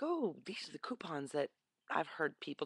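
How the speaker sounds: hushed aside to herself; whisper. Retold or imitated. Shopper eyeing the coupon stand, whispering. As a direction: whisper it like a little secret find.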